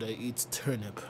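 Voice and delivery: low, quiet Russian cat; deadpan low Russian-cat voice. Pretend. Voicing the supposedly Russian cat.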